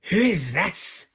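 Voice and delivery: loud, high, indignant demand; rude shout. A narrator's reading. Angry storming in on the dwarfs' meal, rude shout. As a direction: shout it rudely, pitch jumping up. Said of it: "he shouted rudely".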